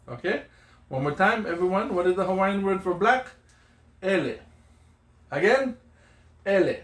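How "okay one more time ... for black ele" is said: ordinary talk, nothing marked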